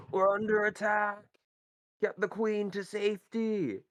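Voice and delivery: high, loud battlefield alarm; officer's shout. Acted. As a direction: call the alarm to the troops.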